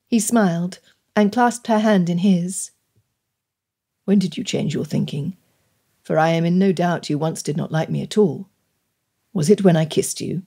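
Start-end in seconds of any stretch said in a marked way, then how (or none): 4.0-10.4 low male character voice; curious gentleman suitor. Acted. Gentleman asking when she changed her mind, curious. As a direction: ask it low, as a courting gentleman.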